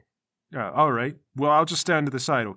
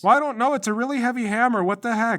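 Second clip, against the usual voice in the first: put-on high-pitched, frustrated kid; whining protest; 9 semitones higher, 3 dB louder than usual. Boy protests failing at hammer.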